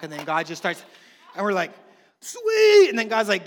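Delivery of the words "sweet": high, loud, whooping delight; thrilled, high-pitched burst; delighted cheer. Acted. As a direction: shout it like you just won big. Acting out delight at money.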